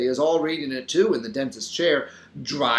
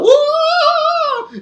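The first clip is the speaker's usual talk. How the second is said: long, very high, loud howl; terrified wail, held high; frightsome howl; 10 dB louder than usual. A narrator's reading. Algebra teacher frightened by ghost, howling. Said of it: "lets out a frightsome howl".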